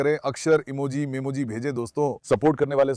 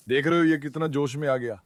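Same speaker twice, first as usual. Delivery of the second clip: ordinary talk, nothing marked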